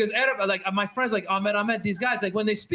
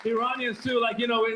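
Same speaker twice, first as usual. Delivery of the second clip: ordinary talk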